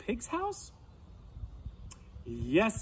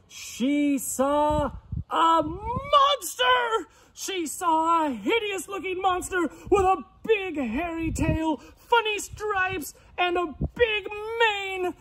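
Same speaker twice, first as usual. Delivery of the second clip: high, dramatic reveal, stretched out; alarmed narration; 13 semitones higher, 4 dB louder than usual. A narrator's reading. Storyteller reveals the monster at the keyhole, high and alarmed. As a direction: build horror for young listeners.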